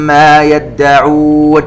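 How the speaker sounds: slow, elongated tajweed chanting; Quran recitation. Shown as a demonstration. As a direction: recite it slowly and melodically. Recitation demo. (تلاوة مجودة بطيئة)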